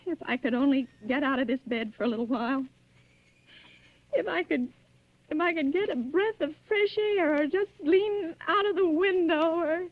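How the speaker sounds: ordinary talk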